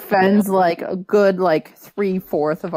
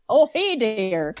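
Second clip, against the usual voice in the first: drawn-out, folksy Minnesotan greeting; Minnesotan accent; 4 semitones higher, slower than usual. Acted. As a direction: lay on a slow Minnesotan lilt. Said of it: "I adore that accent".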